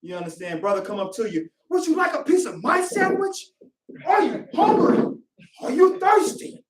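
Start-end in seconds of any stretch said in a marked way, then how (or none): 1.7-6.6 high, eager, over-friendly offers; overly happy. Acted. Happy brother offering to share his food, high and eager. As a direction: gush hospitality at a high pitch. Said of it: "Just happy".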